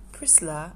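soft "Priscilla" said as Prislah; lightly voiced pastor, gentle "Priscilla"; pastor's fond Priscilla. Pastor who calls her my daughter, tenderly. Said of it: "when my pastor says".

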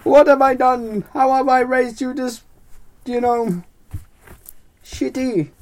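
high, loud mock wailing; hammed-up tearful father lament; crying and whimpering. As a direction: wail it out in melodramatic despair. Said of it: "he starts crying and just moping and whimpering".

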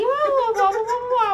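high, loud silly blah blah; mock blabbering. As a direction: babble dismissively.

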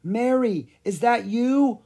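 high, hopeful call; astonished question. A narrator's reading. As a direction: ask in surprise who is out there. Wizard calls to the ghost.

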